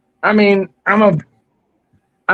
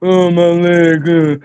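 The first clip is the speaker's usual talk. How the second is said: slow, drawn-out mock whimper; milk the fake pain, slowly; mocking whine; 3 dB louder, slower than usual. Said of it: "that shit pissed me off".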